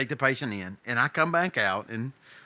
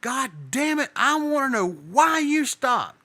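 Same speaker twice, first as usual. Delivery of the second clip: retold angry yell, much higher and louder; angry boss; 11 semitones higher, 4 dB louder than usual. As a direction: imitate the boss losing his temper, slowing down. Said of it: "He is mad".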